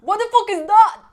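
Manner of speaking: hammed-up British accent. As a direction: bark it like an angry English bloke. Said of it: "J'essaie de t'imiter".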